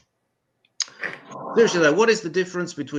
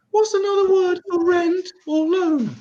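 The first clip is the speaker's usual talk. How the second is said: much higher, slower retake; high squeaky repeat; 6 dB louder than usual. Shown as a demonstration. Comic repeating the joke for Americans, high squeaky voice. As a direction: squeak the question out high and slow. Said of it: "the other one".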